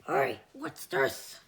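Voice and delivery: deep creature puzzling over a bag; monster voice. Acted. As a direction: growl it like a big creature.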